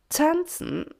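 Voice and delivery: high, scornful echo breaking into laughter; amused snort. A narrator's reading. Doña Rosa ridicules Pina's dancing, snorting in amusement. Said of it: "schnaubte Doña Rosa amüsiert".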